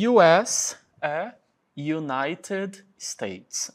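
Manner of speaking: very slow careful "United States"; drawn-out American "United States"; slow English demo. Shown as a demonstration. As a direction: spell out "U S é United States" word by word.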